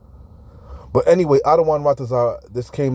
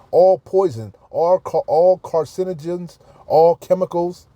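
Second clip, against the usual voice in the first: ordinary talk, nothing marked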